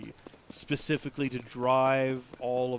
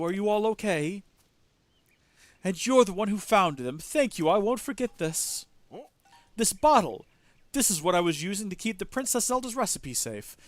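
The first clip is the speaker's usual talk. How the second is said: higher, excited character voice; relieved goat owner; 6 semitones higher, 8 dB louder than usual. Acted. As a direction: act out a grateful animal keeper.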